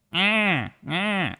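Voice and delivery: short high cat cries, imitated; cat yowl imitation. Showing what the cat noise is like, imitated yowls. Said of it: "it's like a".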